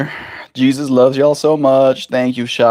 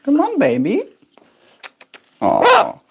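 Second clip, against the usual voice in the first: talking to a pet; 12 semitones higher than usual. Real, not acted. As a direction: coo at the bird. Coaxing pet bird.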